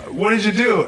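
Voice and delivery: exasperated grandfather. Retold or imitated. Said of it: "he's like".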